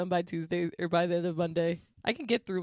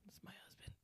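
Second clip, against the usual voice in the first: whispering; 28 dB quieter, faster than usual. Real, not acted. Whispering the husband aside.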